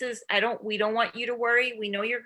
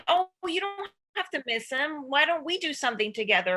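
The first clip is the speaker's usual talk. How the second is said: higher, brushing-off parent voice; dismissive parent; 6 semitones higher than usual. Shown as a demonstration. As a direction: brush the child's feelings aside, lightly voiced. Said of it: "what you don't wanna do is say".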